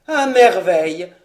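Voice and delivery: lancé fort, plein de dépit hargneux; un père vexé qui crache sa rancune. Acted. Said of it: "dont le dépit hargneux". (à un père offensé, un « à merveille » hargneux et sonore)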